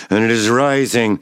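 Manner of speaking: ordinary delivery, nothing marked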